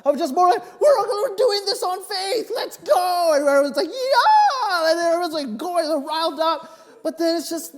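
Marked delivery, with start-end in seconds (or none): 0.8-3.3 higher, louder call to charge ahead on faith; rallying cry. Retold or imitated. Pastor rallying the church on faith. 3.9-4.9 high, loud whoop from the crowd; crowd cheer. Retold or imitated. Congregation cheering back.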